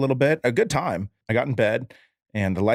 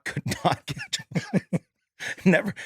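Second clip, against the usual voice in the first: laughing; 8 semitones higher, 3 dB quieter than usual. Real, not acted. Echoing friend, cracking up laughing.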